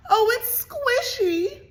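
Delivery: high, loud, slow sob; sobbing. A narrator's reading. Annabelle after the egg prank, sobbing high. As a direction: wail it out through tears. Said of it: "she sobbed".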